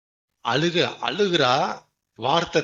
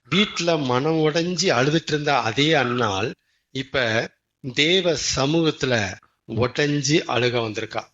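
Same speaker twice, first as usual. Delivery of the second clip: normal talking voice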